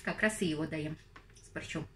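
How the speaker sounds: chewing while talking; talking between bites; mouth full. Real, not acted. Seen 0: Bites sandwich at -4; cheeks full, chewing while speaking until ~3s.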